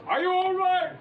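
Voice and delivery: high, loud call across the set; loud, high concerned call; shouted question. Retold or imitated. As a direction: shout the worry out. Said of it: "Pierrot said".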